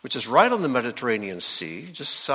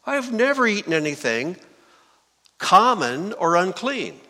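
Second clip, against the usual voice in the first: emphatic refusal; 6 semitones higher, 4 dB louder than usual. Acted. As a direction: protest in a raised, louder voice. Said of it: "But Peter said".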